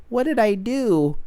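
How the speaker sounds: high, drawn-out baffled question; mock innocence. Podcaster imitating the sister facing arrest, mock innocence.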